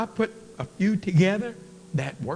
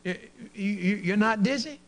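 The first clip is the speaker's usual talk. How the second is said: high stuttering doctor, mock shocked; a hint of a flustered doctor; stammering disbelief; 6 semitones higher, 5 dB louder than usual. Doctor stammers at cocky patient, mock surprised.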